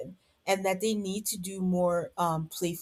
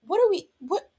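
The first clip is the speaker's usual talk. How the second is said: a high, louder disbelieving yelp; baffled; 12 semitones higher, 5 dB louder than usual. Retold or imitated. Host reacts to Courtney's mood swing in high disbelief. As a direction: react as if you can't believe what you saw. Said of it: "And I'm like".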